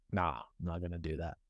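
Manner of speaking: low, quiet brush-off; acted reluctant no, dropped in pitch; dismissive refusal. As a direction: mutter a soft, low refusal. Voices people refusing the call.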